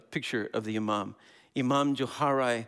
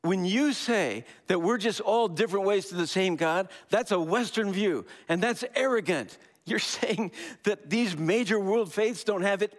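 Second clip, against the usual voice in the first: higher, louder insistent preaching of his point; fervent paraphrase; 8 semitones higher, 3 dB louder than usual. Retold or imitated. Imam rebuking the audience's view, higher and insistent. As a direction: drive the point home, voice pitched up and pressing. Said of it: "he was saying".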